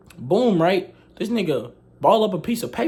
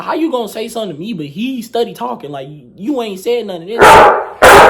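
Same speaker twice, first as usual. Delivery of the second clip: fast, high protest that slows and gets much louder at the end; indignant protest; 4 semitones higher, 11 dB louder than usual. Retold or imitated. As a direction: argue back at the unfair teacher, ending loud. Arguing back at teacher.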